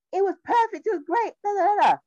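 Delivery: high, sing-song fake enthusiasm; mock-cheerful high-pitched gush; mock gushing praise. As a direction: rattle off empty praise in a high voice. Mocking a fake glowing review.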